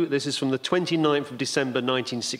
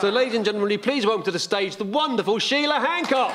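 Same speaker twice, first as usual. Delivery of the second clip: announcer introduction; 5 semitones higher than usual. Real, not acted. Host introduces guest on stage.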